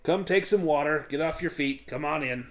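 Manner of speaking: put-on old farmer waving guests inside; hearty farmer welcome. Farrell welcomes them in.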